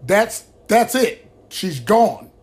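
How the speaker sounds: a grieving daughter yelling the news at her father; retold aggressive cry. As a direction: push it out like a stunned daughter snapping back. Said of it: "got a little bit more aggressive with her statement".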